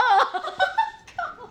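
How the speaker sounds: high laugh that falls away; high wheezing laugh. Real, not acted. Laughing at her own buffet story, high squealing laughter. Cracking up.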